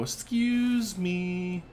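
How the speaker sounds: high, slow squeaky "excuse me"; high, drawn-out mock politeness; mock polite guest. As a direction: pretend to be a tiny guest being shoved aside. Voicing guest being moved.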